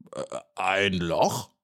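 slow, caught-out "Äh, ein Loch?"; startled, stalling question. A narrator's reading. The startled beetle answers Maja, slow and evasive. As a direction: play dumb, hesitating. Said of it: "Kurt erschrak".

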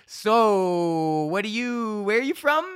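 high, loud forced-bright pivot; awkward deflection. Retold or imitated. Flopped magician pivoting to crowd work, high and forced-bright. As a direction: cover the failure with a chirpy question.

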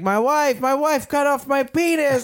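high, panicked wailing about his wife; frantic yell. Retold or imitated. Man in street wailing to strangers, high mock panic. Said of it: "and I was like".

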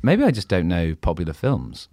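normal talking voice

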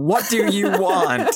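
half-laughing imitation of a dramatic scream; mock scream. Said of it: "in the rain screaming".

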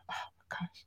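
breathless little whisper. Real, not acted. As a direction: gasp it out under your breath. Gushing over favorite guitarist.

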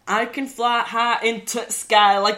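imitating a Yorkshire physics teacher; strong Yorkshire accent. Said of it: "had a really strong Yorkshire accent".

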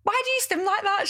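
high, curious asking; curious question. Retold or imitated. Asking her housemate why she stims, high curious voice.

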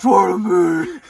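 strained, raspy mock roar; hammed-up growling shout, pushed high and very loud. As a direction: roar it like an over-the-top vigilante.

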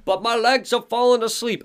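put-on high old-timer complaint; Odin, cranky old man. Odin grumbling to Alan from the booth, high old-timer voice. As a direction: pitch it up like a creaky codger. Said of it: "he looks at Alan and he's like".

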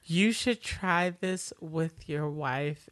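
slow, voiced, measured delivery; unhurried imitation of a needling line; slow deliberate taunt. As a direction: spell out the taunt slowly. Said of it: "you didn't deliver that right".

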